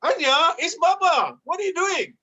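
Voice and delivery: put-on confused dad calling his baby's name; surprised dad. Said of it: "And I'm like".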